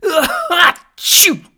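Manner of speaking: very high, loud explosive sneeze; huge sneeze. A narrator's reading. Dragon sneezing over the village, explosive and loud. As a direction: let the giant sneeze blast out. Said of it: "The second blast".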